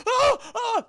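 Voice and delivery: very high mock cries of panic; imitated panicking victim; panicked cries. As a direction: gasp out high, panicky yelps. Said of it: "He's, like, panicking".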